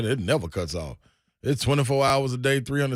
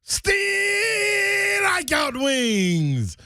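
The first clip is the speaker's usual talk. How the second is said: very high, long drawn-out holler; slogan shout; 4 dB louder, much slower than usual. Acted. Pitchman opening a wings ad, high drawn-out shout. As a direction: stretch it out like a stadium hype cry.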